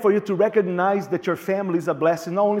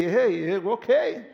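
voice of the Lord; slower than usual. Retold or imitated. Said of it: "And Lord's gonna say".

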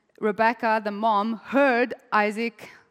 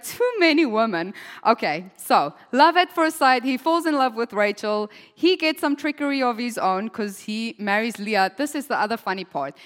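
usual speaking voice

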